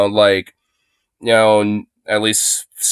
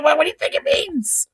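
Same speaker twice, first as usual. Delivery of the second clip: ordinary talk, nothing marked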